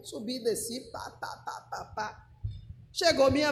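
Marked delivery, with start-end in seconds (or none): 0.0-2.3 very soft, low rattle; muttered summary. Retold or imitated. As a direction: skim through it under your breath. Skims over retold events.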